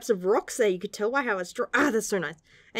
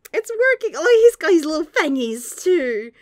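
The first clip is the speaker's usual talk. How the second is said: excited squeal; 8 semitones higher, 7 dB louder than usual. Real, not acted. Excited over cute manga panel.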